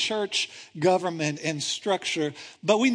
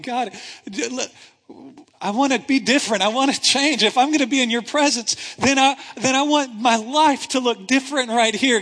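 louder, pressing plea to God; desperate plea; 4 semitones higher, 5 dB louder than usual. Acted. Son crying out to God, loud and pleading. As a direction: beg God hard to be made new.

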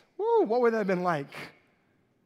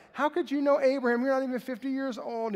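higher, scornful disbelief; mocking Pharisees; 6 semitones higher than usual. Pharisees laughing at Jesus, scoffing in higher voice. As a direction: scoff at him like a skeptic. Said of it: "And they laugh at him. They're like".

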